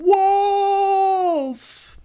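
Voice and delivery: long high howl of a shout; last desperate scream of wolf; bursting cry. Acted. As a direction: let it burst out of you. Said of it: "finally it bursts out of him".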